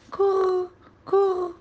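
high coo imitating a baby; cooing back. A narrator's reading. Joud answering baby brother, cooing back. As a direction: echo the infant's coo, high-pitched. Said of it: "أرد عليه وأقول".

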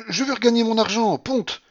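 very high, loud demand; insistent outburst. A narrator's reading. Grandmother demanding to win her money back, insistent. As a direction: insist on it stubbornly.

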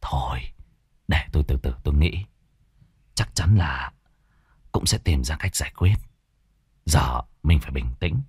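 dỗ dành vợ thật nhẹ nhàng; gentle reassurance. A narrator's reading. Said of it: "nhẹ nhàng an ủi". (chồng nhẹ nhàng an ủi vợ đang hoảng, giọng nhỏ trầm)

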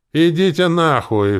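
loud, high, drawn-out bellow; angry roar. A narrator's reading. Gambler cursing the whole company, roaring. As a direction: roar it out slowly, furious. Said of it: "взревел Рене".

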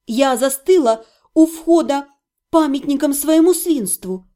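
plain reading voice

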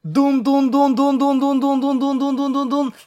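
high rapid-fire dun-dun-dun; childlike machine-gun noise; imitated gunfire. Pegasus playing at war, vocal gunfire.

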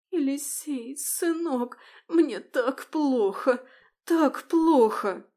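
high, slow tearful pleading. Acted. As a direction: beg him with faked tears. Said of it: "взмолилась".

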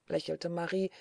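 ordinary delivery, nothing marked

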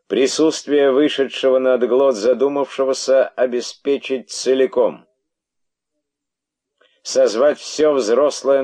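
loud, high royal decree; a regal snake barking orders; python king. Acted. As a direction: proclaim it like a serpent king. Python king's decree, voiced.

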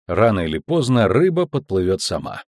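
ordinary delivery

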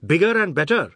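loud, high, indignant topper; angry boast. A narrator's reading. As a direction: cap the boast angrily. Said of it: "Jagdat said angrily".

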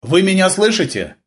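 high-pitched, projected question; calling out. A narrator's reading. Gleb checks Eva can hear, calling out. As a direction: call across to check she hears. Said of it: "крикнул он".

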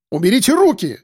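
doctor shouting at the grabbing man. Acted. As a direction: yell to get his hands off you. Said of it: "закричал тот".

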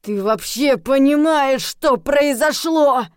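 angry near-shout, drawn out; nearly shouting. A narrator's reading. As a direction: bellow it word by word. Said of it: "практически переходя на крик".

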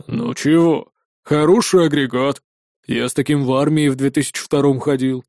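put-on low, wistful old man; nostalgic sadness. A narrator's reading. Old armourer recalls his army pistol, nostalgically sad. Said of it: "с ностальгической грустью ответил Базилич".

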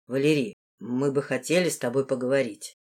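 lightly voiced man of authority; composed lord. A narrator's reading. The lord opens a talk over supper, low and composed.

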